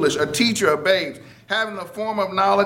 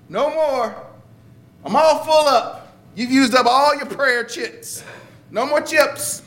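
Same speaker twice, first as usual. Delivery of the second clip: much higher, hammed-up refusal; mock stingy God; 8 semitones higher than usual. Preacher mocking a God who rations prayers, hammed-up and high. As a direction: tease the idea of a God who runs out of patience.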